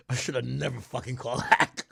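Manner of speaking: [name] line through laughter; laughing [name] impression. Streamer imitating [name], laughing as he says it. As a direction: chuckle through the regret. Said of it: "You know what [name] said?".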